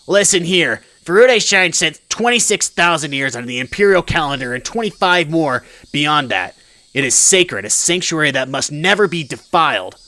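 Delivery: loud, pompous indignation; indignant tirade. Acted. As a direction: lecture them like an outraged mob boss. Congressman rants about the sacred shrine.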